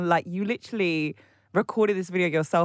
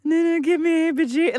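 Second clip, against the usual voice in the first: higher-pitched mock quote of her; lightly hammed-up imitation, pitched up; mimicking woman on video; 9 semitones higher, 4 dB louder than usual. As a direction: imitate her in a raised, put-on voice. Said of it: "She's like".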